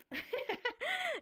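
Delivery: high, soft giggle; laugh. Real, not acted. Teacher shows students the hot dog sign, soft high giggle. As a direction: giggle softly and high, mid-explanation.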